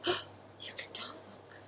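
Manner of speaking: breathless slow whisper; stunned whisper. Acted. Girl astonished the mice can talk, whisper. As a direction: whisper it in disbelief.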